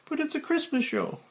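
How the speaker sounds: high prim woman's voice, read as the censor; the censor objecting, voiced high and primly; lady censor. Censor answering Frank, in a high woman's voice. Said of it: "Lady Censor".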